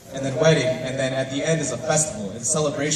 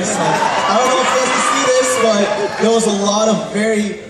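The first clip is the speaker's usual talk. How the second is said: excited; 7 semitones higher, 7 dB louder than usual. Real, not acted. Judge gushing over performance.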